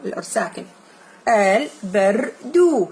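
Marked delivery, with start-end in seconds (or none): 1.3-2.9 slow model reading. Shown as a demonstration. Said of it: "teacher models slow reading".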